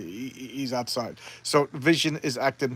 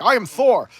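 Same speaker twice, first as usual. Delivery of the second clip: booming, higher-pitched heroic boast; Thor; 8 semitones higher, 7 dB louder than usual. Acted. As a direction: proclaim it loud like a thunder god. Voicing Thor's proud battle boast.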